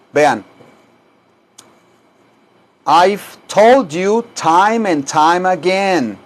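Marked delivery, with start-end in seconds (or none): 2.9-6.2 slow, careful I've told you time and time again; slow clear demo. Shown as a demonstration. Teacher modelling the English sentence slowly for students. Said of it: "vean cómo se pronuncia aquí".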